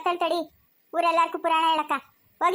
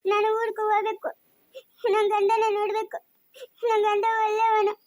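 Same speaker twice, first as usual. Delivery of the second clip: crying; 3 semitones higher than usual. Acted. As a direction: wail like a homesick child. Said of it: "ಯಾಕೆ ಅಳ್ತಾ ಇರೋದು". (ಗೋಳಾಡುತ್ತಾ ಅಳುವುದು)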